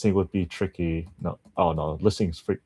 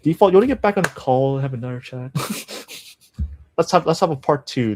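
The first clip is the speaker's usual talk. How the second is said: high, loud, eager call; loud, eager shout-out; excited invitation; 7 semitones higher, 5 dB louder than usual. Real, not acted. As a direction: hail him back onto the call. Calling a viewer back on.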